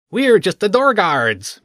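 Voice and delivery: high, slow, sing-song guard voice; lilting, lightly voiced guard; tuneful lilt. A narrator's reading. Mish the guard answers the children with a tuneful lilt. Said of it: "a tuneful lilt to their voice".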